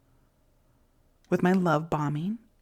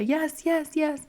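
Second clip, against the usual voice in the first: eager yes; 9 semitones higher, slower than usual. Retold or imitated. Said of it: "and I'd say".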